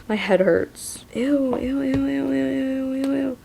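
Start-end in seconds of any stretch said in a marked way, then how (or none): 1.1-3.4 high repeated ew, ew of disgust. Real, not acted. Reader squirms at the umbilical cord, high repeated disgusted ews. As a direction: squirm through each ew.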